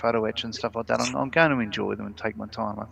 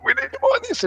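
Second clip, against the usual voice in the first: dad voice; 3 dB louder, faster than usual. Retold or imitated.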